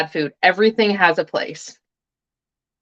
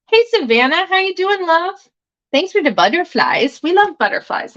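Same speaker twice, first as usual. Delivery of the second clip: much higher, cooing hello to a friend; sweet greeting; 9 semitones higher than usual. Real, not acted. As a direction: lift the voice high and gush with thanks. Greets a viewer, thanks for butterflies.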